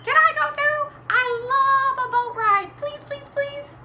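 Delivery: high, squawky, excited begging; pleading chicken. Acted. Chicken asks Mister Gumpy for a ride, high pleading. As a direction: beg as a very high-pitched hen. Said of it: "Chicken said".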